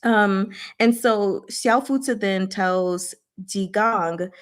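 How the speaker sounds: ordinary talk, nothing marked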